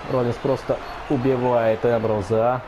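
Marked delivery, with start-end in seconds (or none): none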